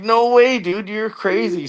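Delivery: high, laughing mock medic voice; amused disbelief. Paramedics laughing at the joking patient, high amused disbelief. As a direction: toss it off like a stunned, amused medic.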